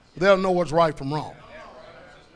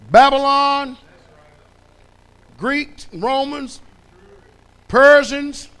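high, loud roll call of empires; preaching at full force; 5 semitones higher, 7 dB louder than usual. Acted. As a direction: build each name louder. Listing captivities, full force.

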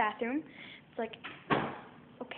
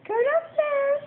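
shouting; 7 dB louder, much slower than usual. Real, not acted. Calling the dog.

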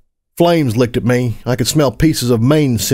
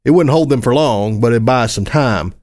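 ordinary delivery

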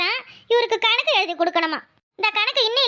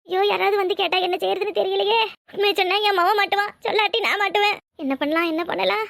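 ordinary talk, nothing marked

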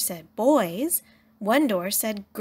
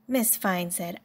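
ordinary delivery, nothing marked

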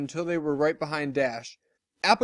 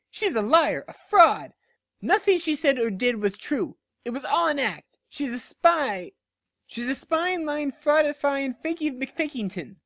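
much higher, shrill name-calling; tearful outburst; 10 semitones higher than usual. Acted. Pinkie Pie denouncing Lyra as a fake, in a tearful outburst. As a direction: let emotions spill over. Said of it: "The pink pony's outburst".

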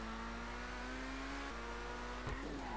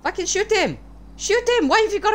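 high frantic yelling; shouting; 8 semitones higher than usual. Real, not acted. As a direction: yell at them to shoot. Urging police to shoot.

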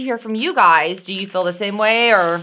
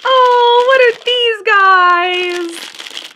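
excited; 13 semitones higher, 5 dB louder, much slower than usual. Real, not acted. Delighted discovery of new item.

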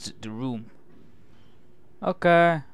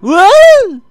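very high, very loud shriek; startled yell; 17 dB louder than usual. Real, not acted. Player startled by a naked man, startled yell. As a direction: yell out in sudden shock.

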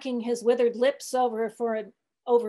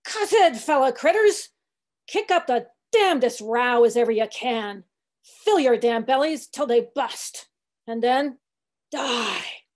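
crying out; 3 semitones higher, 7 dB louder than usual. A narrator's reading. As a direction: cry out a sermon, fading on the last word. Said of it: "raised his shrill voice and cried".

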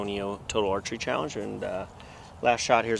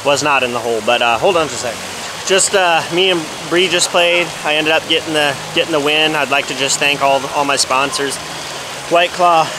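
loud hammed-up champion's interview; mock victory speech; 5 semitones higher, 13 dB louder than usual. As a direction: thank the sponsors like a pro athlete. Mock winner's sponsor speech.

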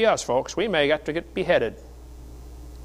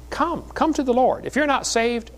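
high-pitched, pleading call; impassioned preacher's appeal; urgent invitation; 7 semitones higher than usual. Real, not acted. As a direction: call sinners forward with rising fervour.

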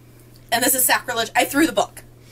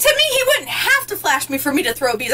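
ordinary delivery

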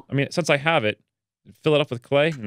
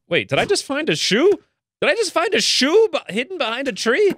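baffled; 13 semitones higher than usual. Real, not acted. Old character baffled by a shoe, squealing. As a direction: squeak in total confusion.

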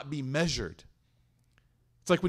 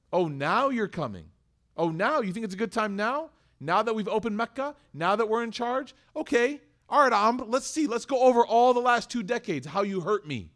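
sarcastic telling-off, rising higher and louder; letting someone have it; 5 semitones higher, 3 dB louder than usual. Acted. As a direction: act out a scornful, building tirade. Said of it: "I'm gonna let you have it".

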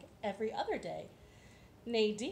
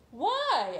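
hen voice; 6 semitones higher, 9 dB louder than usual. Acted. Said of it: "asked Maud".

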